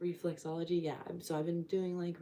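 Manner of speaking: ordinary talk, nothing marked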